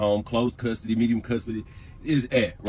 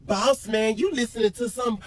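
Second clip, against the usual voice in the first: mocking her; 10 semitones higher than usual. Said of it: "this bitch talking like".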